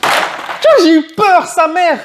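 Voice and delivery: loud, high yell of relief after a scare. Real, not acted. Unboxer after a booster scare, yelling in relief. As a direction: yell out the fright you just had. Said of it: "Pardon, j'ai gueulé".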